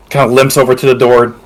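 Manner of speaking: ordinary delivery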